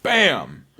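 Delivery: a punchy imitated slam noise; mock impact. Showing the hit on the kid, a punchy mock impact sound. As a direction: bam it out like a hit. Acting out the shove.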